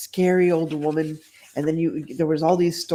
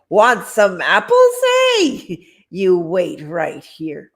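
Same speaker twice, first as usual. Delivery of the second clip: put-on grumpy old man; 6 dB louder, slower than usual.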